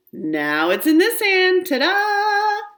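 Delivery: showman reveal. Acted. Kid performs a magic trick for unimpressed siblings, hammed-up showman. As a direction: sell the trick like a stage magician.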